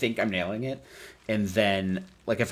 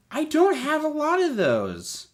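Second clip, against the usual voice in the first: high drawn-out voice; 4 dB louder, slower than usual. Pretend. Odd drawn-out voice mid chat.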